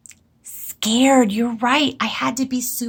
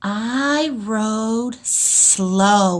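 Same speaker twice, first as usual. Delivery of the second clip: stretched out word by word as she writes; slow dictation; much slower than usual. Shown as a demonstration. Teacher sounds out her sentence while writing for the class. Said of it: "teacher writes sentence".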